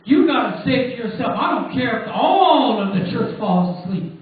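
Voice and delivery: loud, driving preacher's call; fiery preaching. Acted. Preacher rousing the small church, loud and fervent. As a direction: preach it out with full force.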